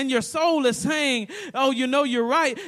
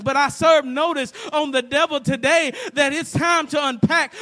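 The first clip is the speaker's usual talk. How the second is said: louder, climbing shouted preaching; preaching at full force; 2 semitones higher, 7 dB louder than usual. Real, not acted. Preacher rousing the congregation against the enemy, building to a shout. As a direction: thunder it out against the devil.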